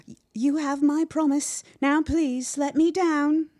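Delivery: high, loud, stammering plea; desperate pleading. A narrator's reading. Trapped boy promising the spider, desperate pleading. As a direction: beg frantically to be let down.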